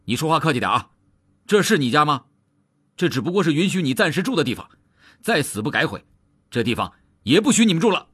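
high-pitched, indignant scolding, rushed in bursts; stern retort. A narrator's reading. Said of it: "也厉声说道". (厉声反驳，尖着嗓子训斥)

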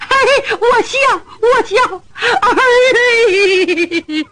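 high, tearful laughter; mother laughing through her tears. Acted. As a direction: laugh while still crying. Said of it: "你笑笑".

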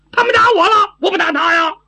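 high, loud wail of complaint; aggrieved protest. Acted. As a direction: protest your innocence at a high pitch. 傻丫头打架后喊冤争辩. (委屈地高声争辩)

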